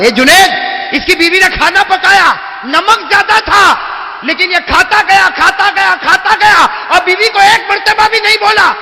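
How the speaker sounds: high, loud impassioned sermon shout; preaching at full force. Acted. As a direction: thunder it to the crowd. Fiery sermon climax. (پورے جوش سے خطابت)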